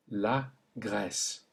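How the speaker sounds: slow la Grèce with open è; la Grèce slowly; French è. Shown as a demonstration. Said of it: "Repeat them one more time".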